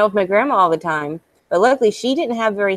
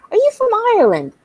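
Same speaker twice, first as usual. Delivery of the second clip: high, quick imitation of a curious waiter; retold waiter's question; 4 dB louder, faster than usual. Retelling the waiter asking about her accent, high quick question. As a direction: pitch the retold question way up, rattled off. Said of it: "and he's like".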